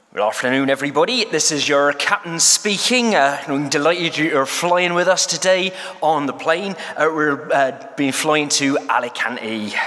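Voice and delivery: a broad West Country pilot, warm and rolling; West Country accent. Shown as a demonstration. Pilot greeting passengers over the intercom, in a West Country accent. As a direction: play a West Country captain on the intercom, a bit hammed. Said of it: "an appalling attempt at a West Country accent".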